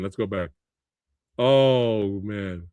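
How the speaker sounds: usual speaking voice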